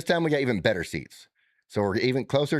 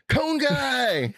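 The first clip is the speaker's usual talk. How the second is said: high shouted call across the pool; high excited shout of recognition; called out cheer. Retold or imitated. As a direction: holler it like strangers spotting you. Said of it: "they go".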